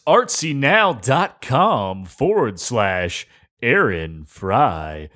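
ordinary delivery

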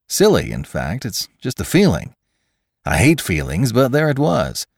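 animated, rising and falling enthusiasm; chatty scientist McClure. Acted. Scientist explains his hunch about the morgue, eager and animated. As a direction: ramble eagerly through your reasoning.

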